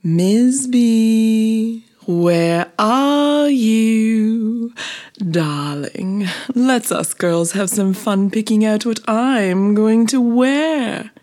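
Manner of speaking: high, loud diva calling out; Wannabe. A narrator's reading. Vain bee calls her friend to help dress, drawn-out high call. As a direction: stretch the words out like a vain darling. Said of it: "called out Wannabe".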